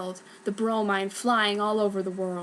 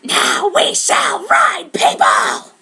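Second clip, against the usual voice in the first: much higher, louder, very slow shout; villain's shout; 10 semitones higher, 10 dB louder, much slower than usual. Acted. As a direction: roar the triumph. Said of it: "said Brom".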